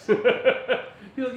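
ordinary delivery

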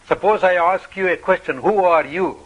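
usual speaking voice

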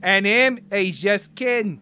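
loud, badly faked Australian; terrible Australian accent. Riffing on Barbie in Australia, terrible Australian accent. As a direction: put on a bad Australian accent. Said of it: "That's a terrible Australian accent".

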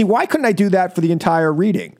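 normal talking voice

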